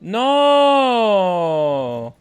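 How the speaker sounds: drawn-out shouted no; long loud no. Real, not acted. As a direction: stretch out a loud protesting no. Drawn-out protest at the game. (long non crié)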